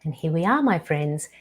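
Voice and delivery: ordinary talk, nothing marked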